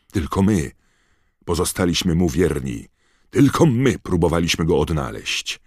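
fervent, ringing declaration of loyalty; calling out. A narrator's reading. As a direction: proclaim it like a fanatical follower. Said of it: "spojrzała na Croucha i zawołała".

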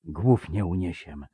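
slow, hushed foreboding; whispered dread. Acted. Someone behind the commander's back, whispered dread. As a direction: murmur it slowly under the breath. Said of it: "zaszeptał ktoś za plecami Puszczyka".

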